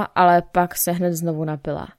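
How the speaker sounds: plain reading voice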